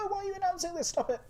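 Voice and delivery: high squeaky mock alarm; Bo's mock protest. Streamer imitates Bo, high and alarmed. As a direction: play Bo panicking at the leak. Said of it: "So Bo's probably going".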